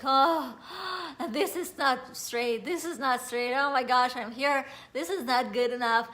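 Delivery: loud, horrified fretting at a flaw; dismayed self-criticism. Retold or imitated. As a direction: re-enact a worked-up fuss over your looks. Re-enacts panicking at own mirror image.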